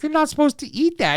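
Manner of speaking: high, prissy mock scolding; scolding owner. Owner scolds her dog, high mock scolding. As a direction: squeak it like a fussy owner. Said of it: "And she's like".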